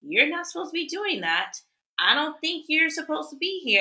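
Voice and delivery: a tattletale adult scolding a stranger; snooty Karen. Retold or imitated. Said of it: "they are the ones who are just like".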